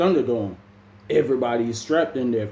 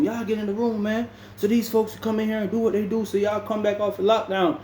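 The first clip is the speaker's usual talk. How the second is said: higher, bossy command; officer's order; 10 semitones higher than usual. Retold or imitated. Officer orders inmates into rooms, higher bossy order. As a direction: bark it like the officer on rounds.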